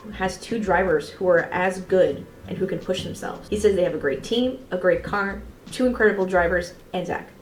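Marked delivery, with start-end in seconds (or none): none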